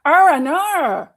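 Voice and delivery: high, loud, drawn-out Australian vowels; Australian accent. Shown as a demonstration. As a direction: stretch it out in an exaggerated Australian accent. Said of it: "You just said, "Oh, I know," in Australian".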